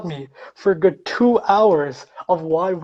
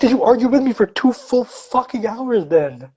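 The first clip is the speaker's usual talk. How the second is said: higher-pitched, incredulous venting; exasperated outburst; 6 semitones higher, 4 dB louder than usual. Retold or imitated. Magician venting about a friend who argued for hours, exasperated. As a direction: burst out in outraged disbelief, reliving the argument.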